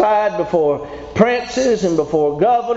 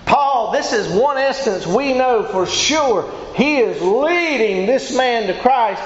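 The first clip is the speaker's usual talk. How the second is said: ordinary talk, nothing marked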